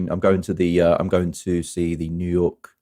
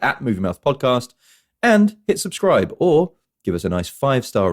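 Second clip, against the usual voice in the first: higher, upbeat announcer-style sign-off; podcast outro; 5 semitones higher than usual. Acted. Host asking listeners to follow and subscribe, podcast outro delivery. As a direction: pitch it up and plug the show, slowing to the end.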